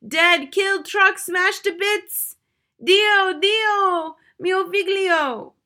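high, loud, slow wailing cry; keen over the lost son at full volume; grief-stricken wail. Acted. Mother shrieks to the family that her son died, loud wail.

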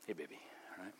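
quiet tender aside to his daughter; soft aside. Real, not acted. Greets daughter in the hall.

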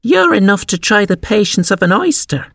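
put-on high, irritated young creature; snappish. A narrator's reading. Young crab scolds her mother, snappishly. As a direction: complain crossly in high pitch. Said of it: "said the young crab a little snappishly".

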